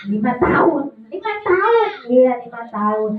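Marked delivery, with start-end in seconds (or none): 1.1-1.9 high puppet repeat; puppet character. Acted. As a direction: parrot the answer back high.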